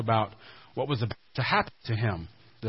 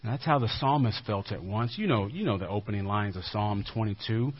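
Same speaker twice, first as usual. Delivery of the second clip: ordinary talk, nothing marked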